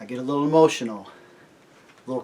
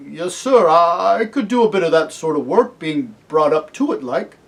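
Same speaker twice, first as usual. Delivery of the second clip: louder, higher working man's voice; humble eager cabbie; 4 semitones higher, 8 dB louder than usual. Acted. Cabbie answering Aslan about farm work, humbly eager. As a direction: answer readily, deferential and plain-spoken.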